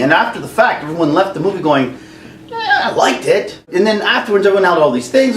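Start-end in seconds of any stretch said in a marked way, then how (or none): 2.5-3.6 high mock audience voice. Audience leaving the movie.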